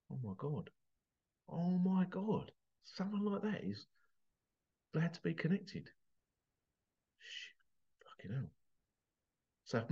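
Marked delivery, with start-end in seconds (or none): 0.1-5.9 barely getting the words out, overwhelmed; choked up. Real, not acted. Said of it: "I'm getting emotional". 7.2-8.5 near-silent curse under tears; choked up. Real, not acted. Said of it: "I might cry".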